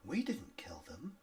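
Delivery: soft, eerie whisper; hushed, sinister hag; different hag's voice. Acted. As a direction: whisper an insinuating denial. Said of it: "A different voice to the other two says".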